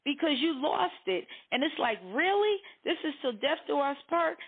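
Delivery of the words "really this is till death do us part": high-pitched, disbelieving question; incredulous. Acted. Spouse who lost the other on the map, incredulous. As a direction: challenge the vow in acted disbelief. Acted spouse doubting the vow.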